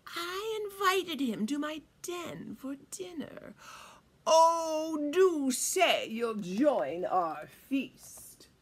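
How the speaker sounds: slow, swooping, over-polite invitation; sly fox. A narrator's reading. Fox inviting birds to his den, slow sly drawl. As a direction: drawl it grandly with a sly smile. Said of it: "said with a sly smile".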